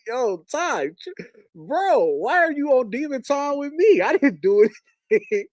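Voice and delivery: high-pitched retold protest, close to laughing; amused protest. Protests at friend while trying not to laugh.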